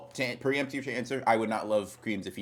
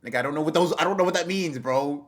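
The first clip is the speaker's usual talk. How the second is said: higher, louder exasperated outburst; exasperated friend, higher and louder; 6 semitones higher, 5 dB louder than usual. Real, not acted. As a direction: demand an explanation, fed up. Exasperated at British slang.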